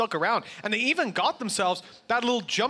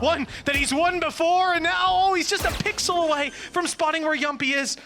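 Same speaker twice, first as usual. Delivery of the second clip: esports caster; 8 semitones higher than usual. Real, not acted. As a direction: build the call to a peak. Live play-by-play hype.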